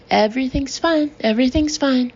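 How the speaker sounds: smooth it over for the cameras; forced brisk reassurance. A narrator's reading. Hillary to the press, forced and brisk.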